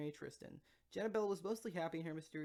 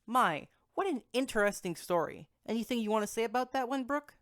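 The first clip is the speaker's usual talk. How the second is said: louder, higher host voice wrapping up; booming host sign-off; show host; 7 semitones higher, 13 dB louder than usual. Acted. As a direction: present it like a radio host closing a segment. Host wraps up the story.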